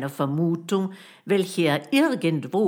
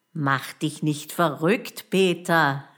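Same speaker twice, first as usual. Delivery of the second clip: ordinary delivery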